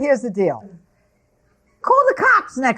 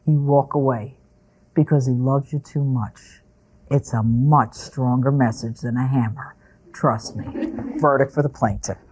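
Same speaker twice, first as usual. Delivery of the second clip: calm; 13 semitones lower, 5 dB quieter than usual. Real, not acted. Judge gives calm final advice.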